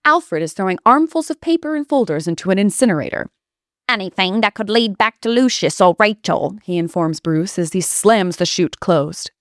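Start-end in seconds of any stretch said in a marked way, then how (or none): 3.9-6.6 Alfred's light Cockney, "Lucius" said the London way; mild Cockney butler reading out a list; a hint of Cockney on "Anything" and "Lucius"; Cockney accent. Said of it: "with that signature Cockney accent".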